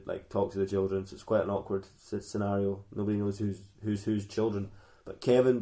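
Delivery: ordinary talk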